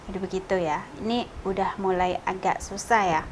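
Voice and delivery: normal talking voice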